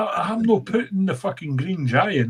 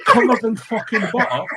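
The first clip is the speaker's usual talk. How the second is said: laughing; 6 semitones higher, 5 dB louder than usual. Real, not acted. Laughing, relishing.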